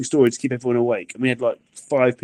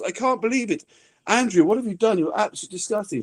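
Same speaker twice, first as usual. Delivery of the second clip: high, scandalised wife voice; mock pearl-clutching outrage; 10 semitones higher than usual. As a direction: scold the husband as if clutching pearls. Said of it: "like, clutching her pearls".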